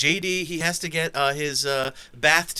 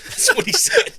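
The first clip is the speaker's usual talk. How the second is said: breathy laughing words; 3 dB louder than usual. Real, not acted. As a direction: laugh through the line. Laughing at misheard cocktail.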